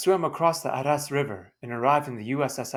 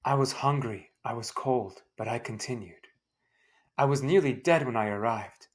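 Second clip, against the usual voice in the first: ordinary delivery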